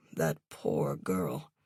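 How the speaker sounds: hushed, slow pity; mournful murmur over a lost student; sad whisper. A narrator's reading. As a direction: sigh it out softly. Teacher laments student's past.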